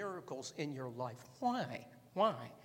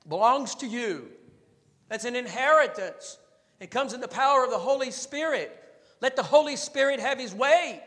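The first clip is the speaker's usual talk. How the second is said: high, loud, driving preacher cry; full-force preaching; 7 semitones higher, 14 dB louder than usual. Acted. Preacher urging his church at full force, loud and high. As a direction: thunder it out to the congregation. Preaching hard on the Holy Spirit.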